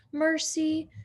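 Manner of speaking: ordinary delivery, nothing marked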